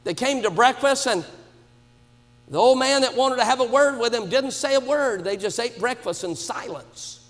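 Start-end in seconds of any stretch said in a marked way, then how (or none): none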